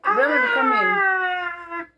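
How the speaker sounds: high, slow call across the room. Real, not acted. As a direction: call him over from afar. Mother calling son across room.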